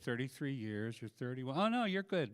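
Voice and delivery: ordinary talk, nothing marked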